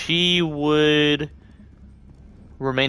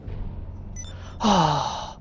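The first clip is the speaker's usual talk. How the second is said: breathy sigh; 3 semitones higher, 5 dB quieter than usual. Real, not acted. Frustrated with the game.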